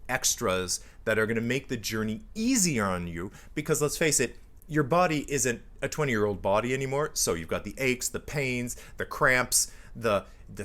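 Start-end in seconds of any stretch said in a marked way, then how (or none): none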